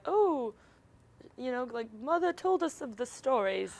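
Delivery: distant, slow echoing recollection; flashback voice. Retold or imitated. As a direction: murmur it like a faded memory. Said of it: "flashback voice".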